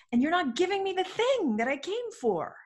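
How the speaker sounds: high, emphatic retold outrage; angry. Client fuming inwardly at paid coach, indignant. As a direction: push the complaint up high, indignant. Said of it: "I got so angry".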